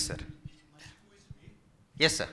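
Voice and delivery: ordinary delivery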